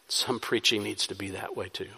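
ordinary talk, nothing marked

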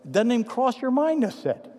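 normal talking voice

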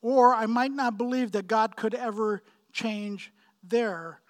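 ordinary delivery

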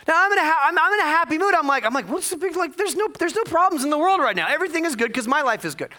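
over-the-top cheerful pitch; gushing happy. Retold or imitated. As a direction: gush like nothing could be wrong.

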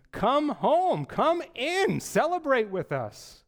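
pleading father. Pretend. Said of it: "begs him".

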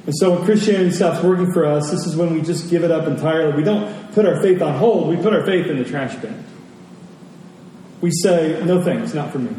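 ordinary talk, nothing marked

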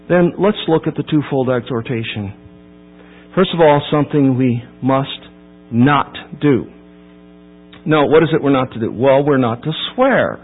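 usual speaking voice

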